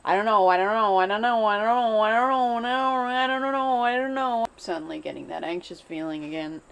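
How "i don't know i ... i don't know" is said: rapid repeated "I don't know". Shown as a demonstration. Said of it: "say "I don't know" over and over again really fast".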